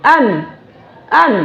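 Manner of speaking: "অ্যান" with the open vowel of ant; careful open-vowelled "অ্যান". Shown as a demonstration. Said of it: "সেই অ্যান্টের মতো উচ্চারণ হবে".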